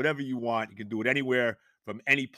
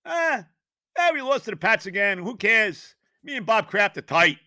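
mock careless owner; 7 semitones higher, 4 dB louder than usual. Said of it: "it doesn't even seem to freaking bother him".

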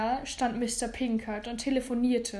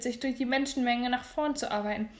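ordinary delivery

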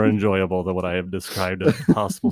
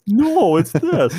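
slow, loud correcting voice; know-it-all medical student; 9 semitones higher, 6 dB louder, much slower than usual. Retold or imitated. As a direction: correct them like a smug med student. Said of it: "a medical student listening to us like".

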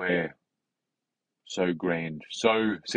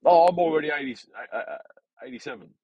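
put-on old person voice; 8 semitones higher, 5 dB louder, faster than usual. Quoting an elderly person.

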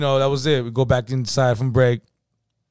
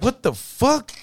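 shocked; 11 semitones higher, slower than usual. Retold or imitated. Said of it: "And I'm like".

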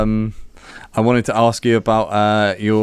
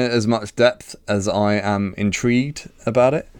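normal talking voice

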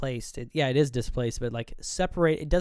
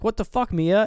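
higher, louder mock outburst; startled; 7 semitones higher, 6 dB louder than usual. He hops up real quick, acted startled outburst. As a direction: act out being startled and appalled.

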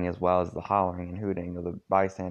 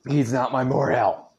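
louder, higher retake of the shout; shouted denial; 5 semitones higher, 6 dB louder than usual. Acted. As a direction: yell the line properly this time. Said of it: "Get some water".

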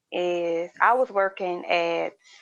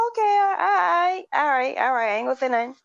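hammed-up backing off, hands up; mock placating; 5 semitones higher than usual. Backing off from rude customer.

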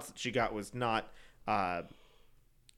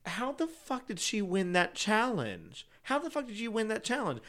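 incredulous; 8 semitones higher, 3 dB quieter than usual. Retold or imitated. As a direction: retell a baffled outburst in a raised pitch. Incredulous reaction to the winner.

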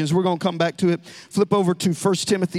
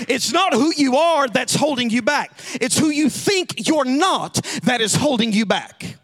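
raised, ringing pulpit voice; preaching at full force; 6 semitones higher, 3 dB louder than usual. Acted. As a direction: drive the point home to the congregation. Preaching climax.

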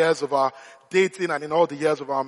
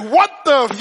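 louder, higher mock exasperation, cut off; exasperated, holding back; 7 semitones higher, 7 dB louder than usual. Parent at the end of patience with crying child, exasperated. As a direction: act a parent stopping short of swearing. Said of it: "holding back the swear word".

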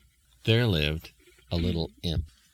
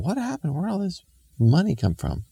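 puzzled surprise; 12 semitones higher than usual. Acted. Walkers puzzled by coins.

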